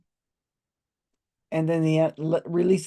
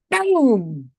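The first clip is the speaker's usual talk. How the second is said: loud boom; 4 semitones higher, 6 dB louder than usual. Retold or imitated. Sound of horn hitting table.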